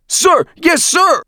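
very high, loud recruit yell; full-force military response; shouted in unison. A narrator's reading. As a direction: scream the reply like eager trainees. Recruits shout back again.

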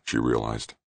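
ordinary delivery

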